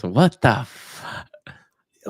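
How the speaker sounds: unvoiced whisper teasing his catchphrase; a whispered mock of a friend's catchphrase; whispered imitation. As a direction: hush it like a shared secret. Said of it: "You always say this".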